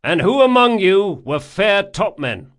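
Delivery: loud, high shouted question; shouting. A narrator's reading. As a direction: shout across the deck. Locke questions crew.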